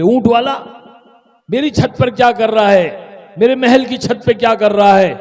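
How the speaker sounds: acted royal indignation; indignant challenge. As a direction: shout up at the intruder, affronted. King shouts at intruder on roof.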